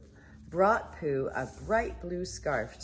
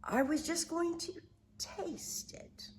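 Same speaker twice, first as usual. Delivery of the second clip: sheepish whisper; 12 semitones higher, 6 dB quieter than usual. Acted. Pooh sheepish.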